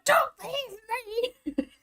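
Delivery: high, far-off pretend scream; hammed-up Coraline scream; mock scream like Coraline. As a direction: shriek it as if fleeing down a tunnel. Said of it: "Screaming my way through the tunnel".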